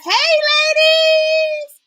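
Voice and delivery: very high, drawn-out singsong; long squealed hello; excited greeting. Real, not acted. Greeting viewers from the live comments.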